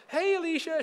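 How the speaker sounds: high, wheedling greeting to a coworker; "I need something" voice. Retold or imitated. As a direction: call out sweetly high, angling for a favor. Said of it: "That's your I need something voice".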